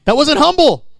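high, loud exclamation; emphatic rebuke. Real, not acted. Preacher correcting false humility before congregation, emphatic rebuke.

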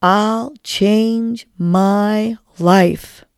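very slow, loud, word by word; drawn-out, emphatic mantra; group affirmation. Shown as a demonstration. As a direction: belt out each word separately for others to join in. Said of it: "Let's say it together".

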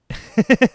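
a quick amused burst of laughter; short laugh. Real, not acted. Amused that the actor is English, brief laugh. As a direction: laugh at the surprise.

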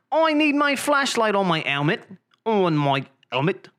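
mock British with "helmet" as 'elmet; hammed-up British, H missing from "helmet"; British accent, dropped H. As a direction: drop the H on "helmet", British style. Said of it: "Pronounce your H's".

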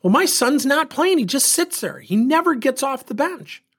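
high-pitched imitation of an aggrieved parent; upset mother. Mother complaining to coach about her benched son, mock upset. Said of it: "I'm really upset".

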